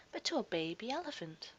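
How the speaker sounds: plain reading voice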